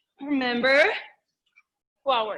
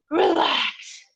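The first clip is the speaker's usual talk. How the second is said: usual speaking voice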